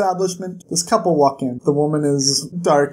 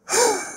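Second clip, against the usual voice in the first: high shocked gasp. Real, not acted. Shocked reaction.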